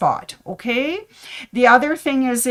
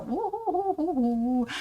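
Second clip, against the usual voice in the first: high, breathy little cheer; a quiet, giddy woo-hoo; excited woo-hoo; 7 semitones higher, 7 dB quieter than usual. Real, not acted. Cheering about decorating the classroom.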